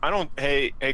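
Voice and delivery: ordinary talk, nothing marked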